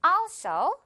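also with a strong, long al; stress on first syllable. Shown as a demonstration. Teacher repeats the model for learners, front-stressed also. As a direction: lean on the start of also. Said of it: "앞에 있는 음절이 오늘 이깁니다".

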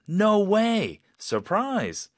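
high, loud, delighted exclamation; happy surprise. Shown as a demonstration. Teacher showing a very positive reaction, happily surprised. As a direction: exclaim it as pleasant astonishment. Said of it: "그냥 너무 기쁘고 놀랍고".

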